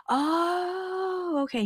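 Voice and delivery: long, high drawn-out "oh"; high mock-enlightened "ohhh"; acted realization. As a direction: act out a drawn-out dawning realization. Retelling her dawning realization to husband.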